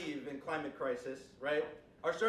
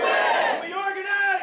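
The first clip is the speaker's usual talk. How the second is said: shouted chant call, much higher; rally speech; 12 dB louder, slower than usual. Real, not acted. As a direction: lead the crowd chant, shouting. Call and response with crowd.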